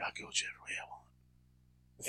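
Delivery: whispered mock defiance; stubborn star. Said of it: "He's like".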